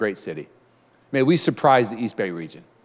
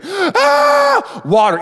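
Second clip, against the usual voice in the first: high, loud desperate scream; screaming for water; 5 dB louder, much slower than usual. Acted. Dehydrated body begging for water, screaming. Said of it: "your body starts to just start screaming".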